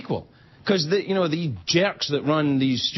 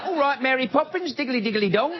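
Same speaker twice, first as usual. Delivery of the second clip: higher, chirpy Mary Poppins Cockney; cheery Cockney sweep; 8 semitones higher than usual. Retold or imitated. Mocking the orcs' accent as a cheerful Cockney sweep. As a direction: sing it out like a jolly chimney sweep. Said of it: "They're cheerful chimney sweeps".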